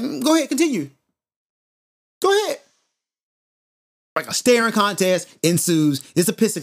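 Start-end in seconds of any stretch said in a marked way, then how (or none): 0.0-2.6 high-pitched, goading go-ahead; mock-patient, pitched well up; mock "continue talking". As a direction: egg them on in a raised, taunting lilt. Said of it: "Continue talking" face, like".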